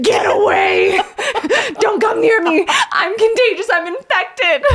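panicked mock shout. Sick wife warning husband to stay away, mock panicked shout.

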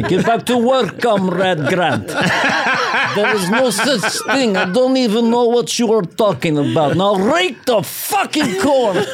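high, slow, barking boss voice, laughing; Prussian overseer. Retold or imitated. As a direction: order the peasant back to work. Prussian overseer scolding the peasant.

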